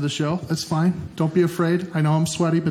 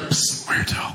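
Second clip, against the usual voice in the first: hissed whisper; slower than usual. Retold or imitated. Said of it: "it was like".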